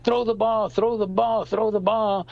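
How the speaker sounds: put-on high, repeated kid's plea; little kid. Little kid in the infield to a fielder, pleading. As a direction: imitate a kid calling urgently for the ball. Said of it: "there was a little kid there".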